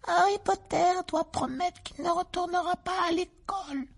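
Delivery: high squeaky elf voice; shrill little creature, insistent; house-elf voice. Acted. Dobby the house-elf speaks.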